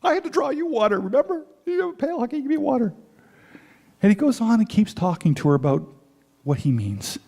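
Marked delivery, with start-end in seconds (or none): 0.0-2.9 much higher incredulous voice; incredulous woman at the well, pitched high; woman's retort. Acted. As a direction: pitch it up, baffled and objecting. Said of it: "And she says".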